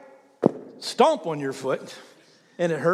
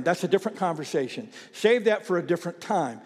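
normal talking voice

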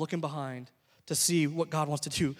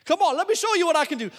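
fired-up defiance; 5 semitones higher than usual. Retold or imitated. Stung player answers his brother's challenge, defiant. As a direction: rise to the challenge, voice climbing. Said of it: "something that kinda rose up inside of me".

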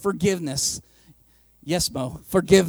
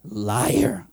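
accusing; 9 semitones lower, 4 dB louder, slower than usual. Retold or imitated. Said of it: "You're like".

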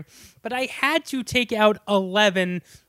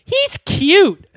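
put-on high, louder swooning teen; teen girl swooning over the poster; mock teen girl; 10 semitones higher, 7 dB louder than usual. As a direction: gush like a smitten teen. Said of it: "the girl looks at me and goes".

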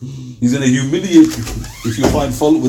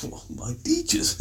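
imitating someone softly taken aback; mock astonishment; 4 semitones lower, 14 dB quieter than usual. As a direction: echo it very softly as if baffled, rising at the end. Acts astonished reaction to answer.

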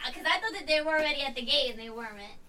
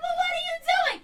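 much higher questioning voice; high-pitched friend voice; imitated friend. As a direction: ask it in a high put-on voice. Friend's quoted voice.

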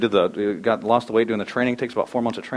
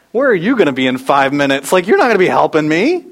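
dismissive; 4 semitones higher, 7 dB louder than usual. Retold or imitated. Dismissive reply to coach.

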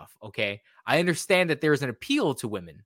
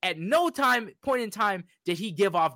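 emphatic rant; 7 semitones higher than usual. Real, not acted. Ranting about the show.

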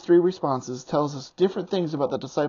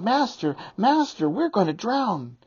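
higher, pleading cry of panic; desperate cry; 7 semitones higher than usual. Acted. Disciples waking Jesus in the storm, desperate high cry. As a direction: call out as if the boat is sinking.

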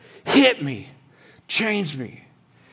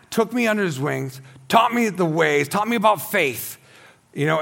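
usual speaking voice

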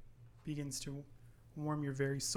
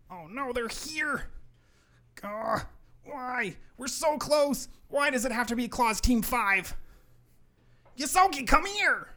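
high, distressed elf voice, growing loud; panicking elf; 9 semitones higher, 7 dB louder, slower than usual. Acted. Elf hearing Claus team at the door, panicking. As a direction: wail in dismay, then shout for help.

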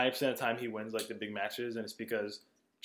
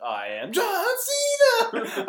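announcer imitation; 9 dB louder than usual. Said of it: "he's like".

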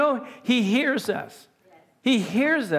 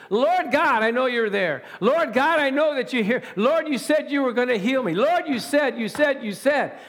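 insistent, higher-pitched proclamation to God; proclaimed cry; 4 semitones higher than usual. Shown as a demonstration. Preacher showing how to call out to God, proclaiming. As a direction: cry it out boldly, hammering each appeal. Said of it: "You proclaim it".

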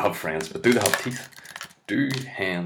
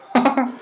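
high burst of laughter; 5 dB louder than usual. Real, not acted. Comic laughing at his own impression, high squeal.

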